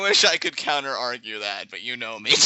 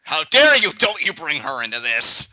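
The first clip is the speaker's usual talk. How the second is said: scandalised, playful yell; mock outrage; 6 dB louder than usual. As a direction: burst out in hammed-up offence. Mock outrage at teasing about Emma.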